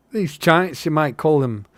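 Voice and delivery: high-starting Irish or Scottish hobbit; wavering Scottish-Irish lilt for a working hobbit; Irish-Scottish accent. Acted. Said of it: "Irish, Scottish with, for Sam?".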